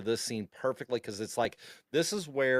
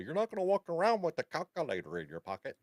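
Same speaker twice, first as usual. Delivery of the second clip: higher-pitched scolding teacher; mock teacher; 6 semitones higher than usual. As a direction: imitate a teacher lecturing a pupil. Mimics a high school teacher.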